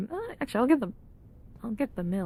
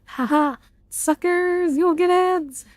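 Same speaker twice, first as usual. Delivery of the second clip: gloating taunt; 5 semitones higher, 5 dB louder, slower than usual. Acted. Taunting chat about ads.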